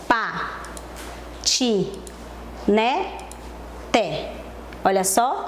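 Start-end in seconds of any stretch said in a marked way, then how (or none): 0.1-4.3 each syllable on its own with a long pause. Shown as a demonstration. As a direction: break "Pa, ti, né, te" into four separate beats. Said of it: "Vamos ver como fica?".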